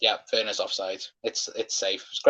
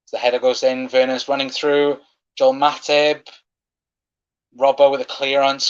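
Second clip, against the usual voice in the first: excited commentary; 3 semitones higher, 5 dB louder than usual. Real, not acted. Live goalmouth scramble.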